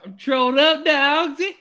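high, loud goofy troll voice; silly voice. Pretend. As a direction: squeal it like a cartoon troll.

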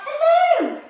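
high, loud yelp of hello; yelping seals. Acted. Seals calling up to the chick, excited yelp. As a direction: bark it out like a playful seal. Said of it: "they clapped and yelped".

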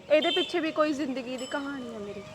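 woman hinting at a painful past; pained. Acted. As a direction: let the old pain slip out quietly. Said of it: "ਤੇਰੀ ਆਵਾਜ਼ ਦਾ ਦਰਦ".

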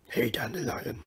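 put-on gruff-hero greeting, kept restrained; Henry Cavill impression. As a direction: try the Witcher in a movie star's voice. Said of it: "try to do this like Henry Cavill".

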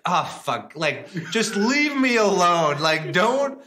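the chef begging the caller to stop calling; mock exasperated chef; fed-up chef. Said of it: "So he basically like".

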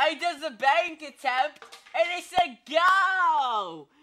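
loud, high, slowed goal yell; goal call. Pretend. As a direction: call the goal like a stadium announcer. Commentator calling a goal.

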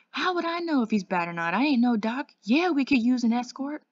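loud, high, indignant comeback; irritated retort. A narrator's reading. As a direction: snap back loudly, pitch jumping up. Snapping back at policeman's question.

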